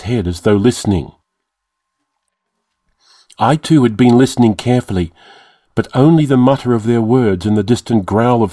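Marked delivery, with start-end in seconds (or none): none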